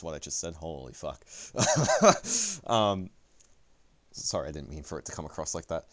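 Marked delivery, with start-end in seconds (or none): 1.3-2.6 high, loud burst of laughter; self-mocking laugh. Real, not acted. Streamer catching himself sounding pretentious, high embarrassed laugh. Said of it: "that sounded really pretentious".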